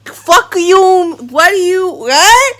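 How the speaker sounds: high, loud incredulous snap; exasperated shout. Retold or imitated. To the hookup who can't find the plug, a loud disbelieving outburst. Acted retort to hookup's reply.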